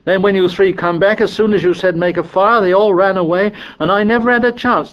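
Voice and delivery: put-on aggrieved boy, high and protesting; exasperated high-pitched excuses; Piggy. A narrator's reading. Piggy defends himself to Ralph.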